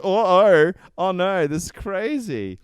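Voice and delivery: high, loud cartoonish dismay; mock alarm. Acting out the audience's delighted alarm.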